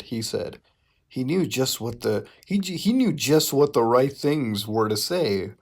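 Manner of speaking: usual speaking voice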